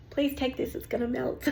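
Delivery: ordinary talk, nothing marked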